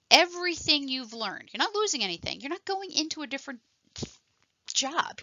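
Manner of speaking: ordinary talk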